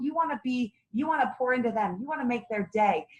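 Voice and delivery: usual speaking voice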